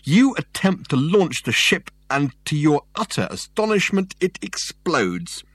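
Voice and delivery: mock the failed launch with scorn; derisive. A narrator's reading. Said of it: "in a voice ringing with derision".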